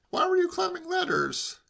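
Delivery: high, pressing question; pry in a high-pitched voice; inquisitive nephew. A narrator's reading. Nephew interrogating uncle about ladders, inquisitive.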